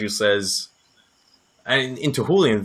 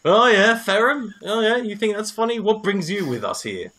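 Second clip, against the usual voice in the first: indignant retort; 9 semitones higher than usual. Acted. Raylan rounding on Ferrum who laughs at him, high indignant retort. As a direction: snap back at the one laughing.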